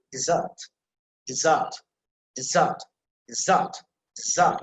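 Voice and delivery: "dessert" drilled with weight on the final "-sert"; stress on second syllable. Shown as a demonstration. Teacher drilling the verb's pronunciation, second syllable stressed. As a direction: repeat "dessert", pausing between, punching the "za" sound. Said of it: "that should be emphasized".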